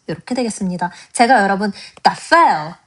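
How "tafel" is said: "tafel" with stress on the first syllable, TA-fel; correct stress demo. Shown as a demonstration. Teacher models the right stress on "tafel" for students. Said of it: "tafel이라고 읽었죠".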